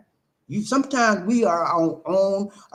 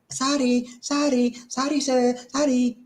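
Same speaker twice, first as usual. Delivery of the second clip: rattled-off sorries, pitched higher; mock insincere sorries; 8 semitones higher than usual. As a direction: parrot empty apologies without meaning them. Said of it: "just comes out their mouth".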